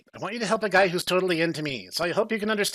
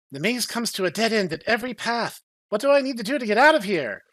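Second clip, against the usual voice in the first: higher, exasperated hero voice stuck in a maze; frustrated Ozma; 6 semitones higher than usual. Acted. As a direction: whine it out like a trapped giantess. Ozma voiced at the dead end.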